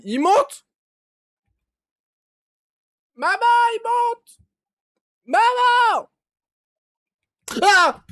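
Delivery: high, loud cries for mom; panicked shouting. Real, not acted. Gamer as the creature rises toward him, screaming for mom. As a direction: scream for help in real terror. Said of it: "excuse-moi d'avoir crié".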